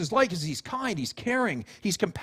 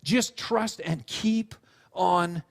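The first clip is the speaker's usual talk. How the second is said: slow, tender, settled voice; unhurried, deliberate encouragement; reassuring parent; slower than usual. Retold or imitated. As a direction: imitate a parent gently building confidence. Parent consoling, after child's wail.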